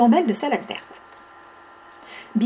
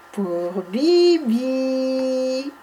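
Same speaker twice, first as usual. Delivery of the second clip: slow drawn-out; 4 dB quieter, much slower than usual. Acted. Answer drawn out.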